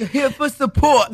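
badly faked Australian, aimed at a nurse; Australian accent. Mocking the Renfrew nurse's line in Australian accent. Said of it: "that was not even the right accent".

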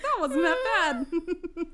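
slow, giggling through it; laughing. Retold or imitated. Telling her friend how she shrugged it off, laughing. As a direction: laugh while drawling it out. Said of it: "I was like".

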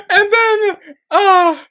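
high, squealing talk through laughter; real uncontrollable giggles breaking up the words; helpless laughter. Real, not acted. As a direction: try to tell it while cracking up. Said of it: "I can't even say it without laughing".

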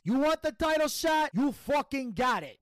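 high, loud mob-family threat; Italian mobster voice. Retold or imitated. Mob family answering their attackers while driving away, hammed-up mobster shout. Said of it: "I have to say it to do the voice".

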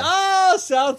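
high, loud hammed-up "oh"; mock amazed shout. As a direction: overplay the amazement. Mock amazement at South America.

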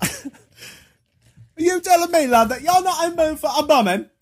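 slow, high Cockney disbelief; mock Cockney accent. Rebuffed Cockney questioning his love, drawn-out accent. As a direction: draw it out in affronted mock Cockney. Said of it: "like, a Cockney accent".

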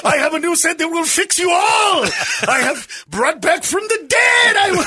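mock messiah. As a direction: promise miracles in a hammed-up preacher voice. Playing a grandiose healer to followers.

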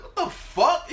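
high, loud shocked exclamation; mom's shocked outburst. Retold or imitated. Mom turning back to the naked kid's mess, shocked outburst. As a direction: burst out loud and high in disbelief.

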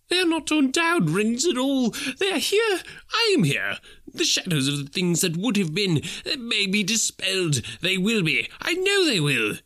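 high, excited cry; Scrooge overjoyed. A narrator's reading. Scrooge clutching his bed curtains, crying out in joy.

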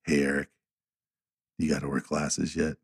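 low, quiet voice of a laid-back friend; Philip. Retold or imitated. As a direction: imitate him talking low and soft. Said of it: "and this is how he talks".

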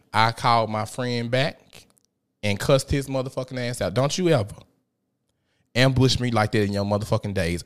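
ordinary delivery

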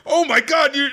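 high-pitched appalled exclamation; mock horror. Retold horror at boiling a cat.

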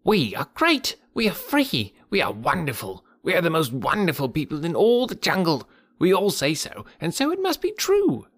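high, chattering monkey crowd voice; monkeys shouting boasts. A narrator's reading. Monkey crowd bragging to Mowgli, high and shrill. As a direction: boast wildly like a pack of monkeys. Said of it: "they shouted".

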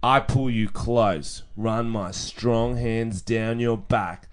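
slow, drawn-out seducer read; mock seductive. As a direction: read it as a cheesy come-on, very slowly. Reading out cheesy seductive message.

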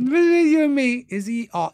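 high, loud parody of a confidential aside; mocking mimicry. Guest echoing host's "just between you and me", mocking mimicry. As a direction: blow up the secretive prompt in mockery.

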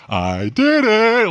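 loud, high, drawn-out retold triumph; triumphant. Retelling the call to his brother, triumphant. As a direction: crow it slowly to your brother.

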